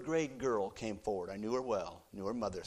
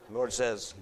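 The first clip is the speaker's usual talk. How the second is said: ordinary delivery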